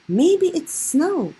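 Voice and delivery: high, slow, wide-eyed wonder; excitedly. A narrator's reading. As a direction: gasp it out like a child seeing snow. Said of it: "he said excitedly".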